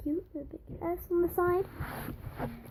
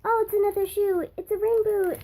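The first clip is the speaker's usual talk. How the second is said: delighted; 7 semitones higher, 6 dB louder than usual. Real, not acted. Delighted by the new toy.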